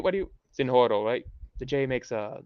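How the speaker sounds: usual speaking voice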